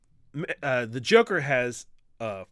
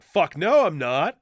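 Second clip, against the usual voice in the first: indignant denial; 6 semitones higher, 9 dB louder than usual. Retold or imitated. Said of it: "Killer Croc is like a whinging grandmother".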